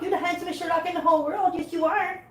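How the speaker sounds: high, quick cooing; doting pet-owner coo. Real, not acted. Cooing flattery to a cat.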